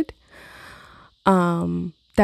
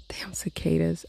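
whisper; 6 dB quieter than usual. Real, not acted.

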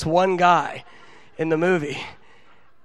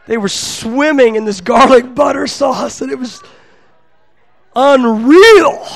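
loud, higher-pitched gush over the food; excited raving; 7 semitones higher, 12 dB louder than usual. Real, not acted. Preacher raves to audience about garlic butter pizza, excited. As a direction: savour it loudly, bursting on the last word.

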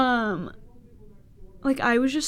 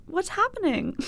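high, innocent little question; sweetly clueless, pitched way up; mock innocence; 9 semitones higher, 3 dB quieter than usual. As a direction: play dumb in a raised voice. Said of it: "Innocent as fuck".

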